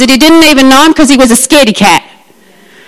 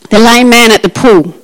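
ordinary delivery

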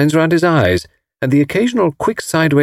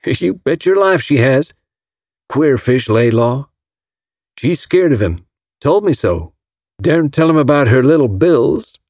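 put-on Alabama accent; 4 semitones higher than usual. A narrator's reading. Hank Ryder confiding Marguerite's troubles, Alabama accent. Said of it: "Mister Ryder came from Alabama".

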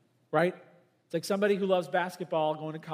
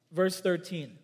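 ordinary delivery

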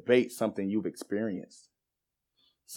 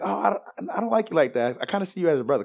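higher-pitched mock girl turning someone down; imitated girl; 8 semitones higher than usual. Girls telling a chubby guy he's like a brother, high imitated rejection. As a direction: let him down in a high imitated voice.

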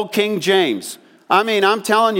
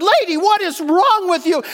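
baffled retort; 12 semitones higher than usual. Retold or imitated. Friend confronts screaming lady.

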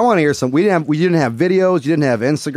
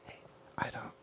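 hushed aside; 3 semitones lower, 15 dB quieter than usual. Real, not acted. Private aside to Matt.

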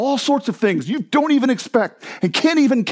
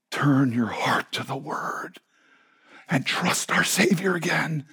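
slow, breathy near-whisper; hushed appeal; 3 semitones lower, 7 dB quieter, slower than usual. Real, not acted. Preacher urging the congregation back to scripture, hushed appeal. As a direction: whisper it slowly as a tender plea.

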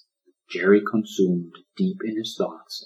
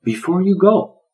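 1 tiny turtle voice; 11 semitones higher, 6 dB louder than usual. Acted.

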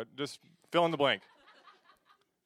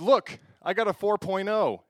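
louder, higher proud boast; proud girl; 6 semitones higher, 8 dB louder than usual. Retold or imitated. Girlfriend showing her report card, proud and bright. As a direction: show it off proudly.

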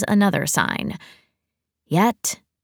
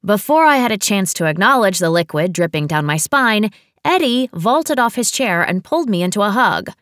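plain reading voice

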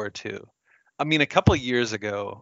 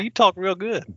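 mock Southern accent; 8 semitones higher than usual. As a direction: tease her in a hammed-up Southern accent. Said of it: "my Southern accent".

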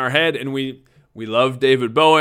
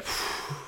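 relieved exhale; 11 dB quieter than usual. Retold or imitated. Relief at son's praise.

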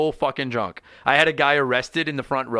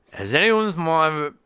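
higher setup line that stops dead; abruptly cut off; 4 semitones higher than usual. Retold or imitated. Drunk comic starting last joke to crowd, cut off abruptly. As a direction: start the joke, then break off mid-sentence. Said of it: "and I just stopped".

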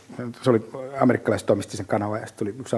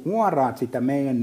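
ordinary talk

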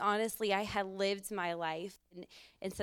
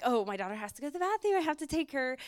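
fast, high eager excuse; hurried excuse; 6 semitones higher, 3 dB louder, faster than usual. Retold or imitated. Woman escaping dinner talk, hurried excuse. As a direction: rush it out to escape the table. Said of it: "I'm like".

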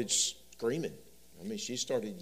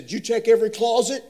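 put-on much higher, louder anxious child voice; scared little boy; 12 semitones higher, 15 dB louder than usual. Frightened son asking his dad about closets, high and loud. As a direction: plead like a frightened kid.

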